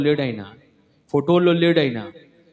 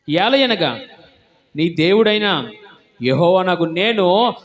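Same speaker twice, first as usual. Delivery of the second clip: louder, slow, weighty declaration; forceful scripture proclamation; 5 semitones higher, 6 dB louder, slower than usual. Acted. Preacher proclaims God's word to the church, loud and slow. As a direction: proclaim God's words like a verdict.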